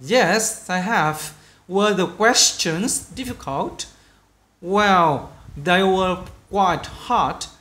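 slow, careful dialogue read-aloud. Shown as a demonstration. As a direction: read it slowly, word by word for learners. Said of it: "cũng chậm thôi".

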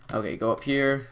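ordinary talk, nothing marked

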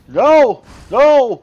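high, loud "no" cries; panicked shout. Real, not acted. Player reacting as the game goes wrong, panicked.

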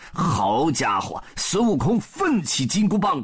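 plain reading voice

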